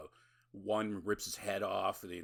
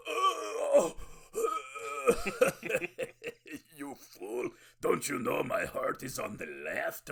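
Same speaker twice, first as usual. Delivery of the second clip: hammed-up gloating vampire; 11 semitones higher, 6 dB louder, slower than usual. Vampire mocks his attacker.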